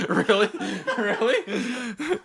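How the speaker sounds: high squeaky laughing really; laughing disbelief. Real, not acted. Reading an absurd patron name, laughing in disbelief.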